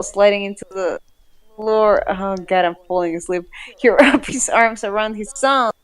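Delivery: normal talking voice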